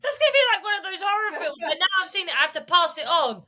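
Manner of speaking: high-pitched playful fright; mock horror. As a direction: squeal it like a horror fan. Mock horror about cursed fairy.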